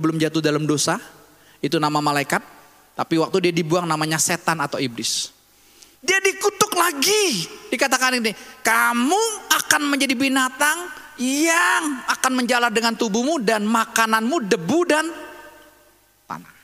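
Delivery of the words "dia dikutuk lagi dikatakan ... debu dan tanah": forceful preaching. Real, not acted. Preacher warning the congregation about the curse, forceful preaching. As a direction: preach it out with stretched key words.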